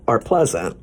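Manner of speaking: ordinary delivery, nothing marked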